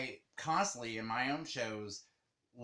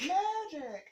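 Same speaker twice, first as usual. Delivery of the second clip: mock magician. Quoting the show's catchphrase.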